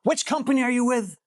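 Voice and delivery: high-pitched seller asking back; mock suspicious seller. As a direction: lightly voice a wary seller. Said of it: "somebody will tell you".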